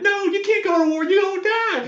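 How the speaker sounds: very high falsetto pleading wife; distressed wife begging her husband not to go, falsetto. Acted. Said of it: "the wife".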